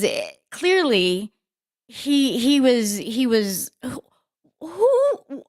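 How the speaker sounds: ordinary talk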